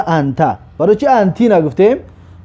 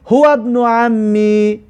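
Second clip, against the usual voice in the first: هو ابن عمی langzaam en hoger, elk woord gerekt; slow, higher recitation; 4 semitones higher, much slower than usual. Shown as a demonstration. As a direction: draag de zin plechtig voor aan de klas. Teacher recites Arabic answer.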